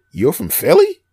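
high-pitched mock disbelief, rising at the end; incredulous question. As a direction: question it as if you can't believe it. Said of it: "people go".

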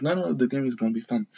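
high, quick, bubbly insisting; a host's mock-chirpy promise of fun; eager insistence. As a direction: rush to reassure them it'll be fun. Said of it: "And Drew is like".

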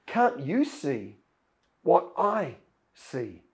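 Elisha's calm reply. Acted. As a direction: gently turn the question back, fully at peace. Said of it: "And Elisha says".

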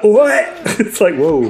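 put-on high-pitched botched British accent. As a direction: blurt it in a mock British accent. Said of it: "tries to do a British accent".

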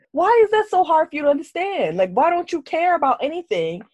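high, loud rhetorical scolding; exasperated questioning. Retold or imitated. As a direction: demand an answer, pitched high and exasperated.

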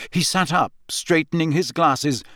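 ordinary delivery, nothing marked

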